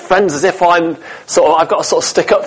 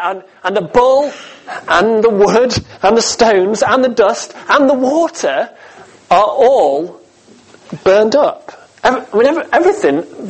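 each item climbing higher in pitch; building excitement; 4 semitones higher than usual. Acted. Preacher describing the fire from heaven, rising excitement item by item. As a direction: build the list to a climax.

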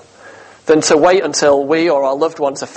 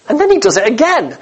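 same words again, pitched higher with disbelief; incredulous repeat; 7 semitones higher than usual. Shown as a demonstration. Preacher to congregation, marvelling at the wasted water, incredulous and higher. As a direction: lift it up in growing amazement.

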